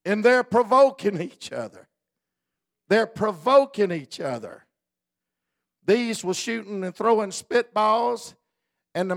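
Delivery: loud, high preaching; preaching at full force. Acted. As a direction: drive it home from the pulpit.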